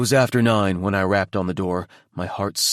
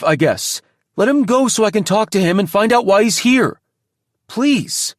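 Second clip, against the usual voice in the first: raised voice; 9 semitones higher, 5 dB louder than usual. Acted. Argument continues.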